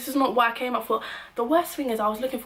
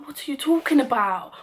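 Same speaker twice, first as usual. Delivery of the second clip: raised, louder angry demand; retold angry shout; 5 semitones higher, 4 dB louder than usual. Yelling at the club manager, an outraged raised voice. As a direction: fire the question back at him, outraged.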